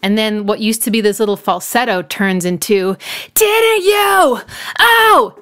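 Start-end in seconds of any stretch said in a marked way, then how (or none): 3.4-5.4 high, louder mock scream that loses its cool; hammed-up enraged cry, much higher; angry scream imitation. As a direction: let the falsetto crack into a furious yelp. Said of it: "And it's just so angry".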